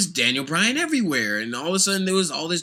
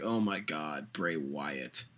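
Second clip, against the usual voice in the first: much lower, softer, fed-up drone; bored groan; 14 semitones lower, 14 dB quieter, slower than usual. Retold or imitated. Vlogger imitates fans later tired of Bray Wyatt, low bored drone. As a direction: sigh it out, sick of him. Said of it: "eventually it was like".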